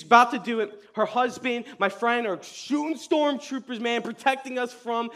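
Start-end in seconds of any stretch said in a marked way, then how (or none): none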